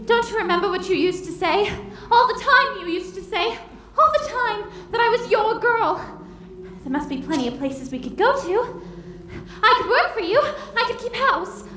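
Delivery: desperate pleading. Acted. Bride begs father.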